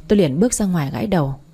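ordinary delivery, nothing marked

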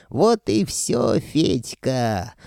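high, drawn-out taunt; gloating villain. A narrator's reading. Sorcerer gloating over the frozen Fedka, triumphant. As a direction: crow over the victim, stretching the words.

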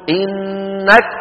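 إنك drawn out slowly, the kaf shut with no breath after; wrong kaf without breath. Retold or imitated. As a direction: imitate the mistake, a locked kaf in إنك. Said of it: "فيقول أحدهم". (إنك بكاف شديدة بلا همس، تقليد الخطأ)

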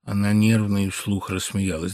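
ordinary delivery

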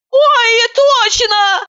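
startled exclamation. A narrator's reading. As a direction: jump up remembering the burning potatoes. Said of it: "вскочила Катерина".

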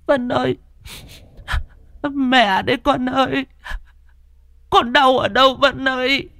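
a mother sobbing over her possessed daughter; tearful mother. Acted. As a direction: call for your child through tears. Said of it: "nước mắt giàn giụa".

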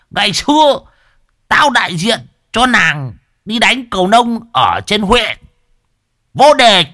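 high, loud bragging voice; boastful shout. Acted. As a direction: brag it like a champion. Old man boasting loudly. (khoe khoang oang oang)

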